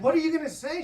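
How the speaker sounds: alarmed question. Retold or imitated. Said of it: "I was like".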